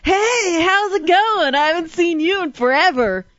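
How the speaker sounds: overly friendly hello to someone who hates you; fake cheerful greeting. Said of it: "you're like".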